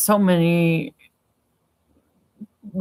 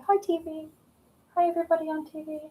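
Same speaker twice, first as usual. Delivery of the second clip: tiny high, softer cat voice; voicing the cat; 11 semitones higher, 7 dB quieter, faster than usual. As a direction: squeak a little greeting for the cat. Voices the greeting for her cat.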